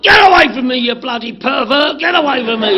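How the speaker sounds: retold yell, high and loud; angry shout. Recalling yelling at the man hugging him, retold angry shout.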